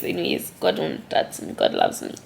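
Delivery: soft breathy whisper, still teary; reassuring herself quietly after crying; whispered through tears. Real, not acted. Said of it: "let me wipe these tears".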